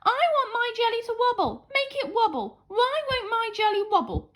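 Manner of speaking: a tearful princess stamping her foot; stomping tantrum. A narrator's reading. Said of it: "she stomped, a royal tear".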